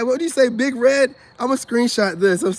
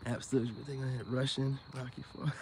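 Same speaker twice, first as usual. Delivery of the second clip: much softer muttered aside; quiet aside; 8 semitones lower, 14 dB quieter than usual. Real, not acted. Joking to chat about Rocky Four, very soft. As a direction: drop it almost under the breath.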